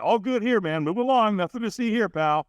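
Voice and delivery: high-pitched, hurried-along denial that anything is wrong; feigned all-fine brush-off. Acted. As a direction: wave them off with put-on breeziness. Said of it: "when we're not okay, what do we say".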